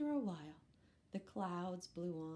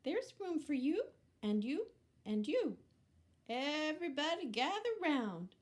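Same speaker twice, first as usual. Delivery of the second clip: higher, louder welcoming truck; cheerful truck voice; 8 semitones higher, 5 dB louder than usual. Acted. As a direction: welcome everyone in like a friendly little truck. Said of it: "said Little Blue".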